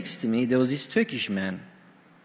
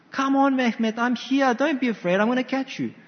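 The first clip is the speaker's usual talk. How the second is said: coaxing Turkish dad; 7 semitones higher than usual. Retold or imitated. Father coaxing son again.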